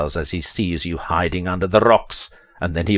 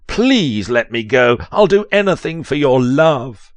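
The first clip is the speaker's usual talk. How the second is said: hammed-up pleading; 6 semitones higher, 5 dB louder than usual. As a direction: beg dramatically, voice climbing high. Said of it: "You say".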